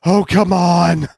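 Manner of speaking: loud chiming protest; playful loud protest. Acted. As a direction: coax them to stay. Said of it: "Justine chimed in, laughing".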